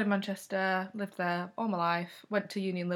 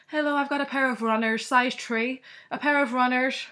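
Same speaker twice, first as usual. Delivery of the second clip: put-on loud, higher-pitched Irish accent; Irish customer; 6 semitones higher, 7 dB louder than usual. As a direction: imitate an Irish shopper, loud and lilting. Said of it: "I'd then get the Irish customer being like".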